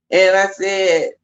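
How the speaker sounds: ordinary talk, nothing marked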